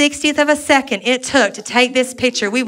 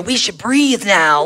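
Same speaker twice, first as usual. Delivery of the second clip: mock dim-witted; 5 semitones lower, slower than usual. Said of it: "to go".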